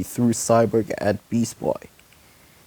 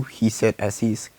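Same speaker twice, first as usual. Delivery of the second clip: usual speaking voice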